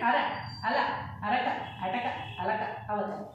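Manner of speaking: quick short అ opening అటక, అలక, అవతల; short vowels. Shown as a demonstration. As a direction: say అర, అల, అరక with a short, unstretched అ. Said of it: "అన్నీ short షార్ట్గా ఉన్నాయి".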